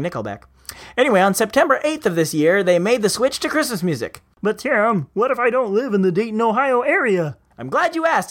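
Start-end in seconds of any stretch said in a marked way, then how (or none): 4.4-7.4 Kermit the Frog. Acted. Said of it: "imaginary listener that kinda sounds like Kermit the Frog".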